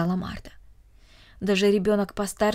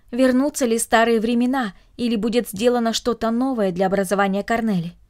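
ordinary delivery

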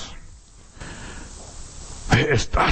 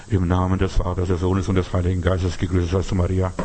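lower, solemn churchy intoning; a hint of a priest chanting the rosary; mock sacred liturgy; 7 semitones lower than usual. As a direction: parody a stiff pious prayer. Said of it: "ich muss alles sakral".

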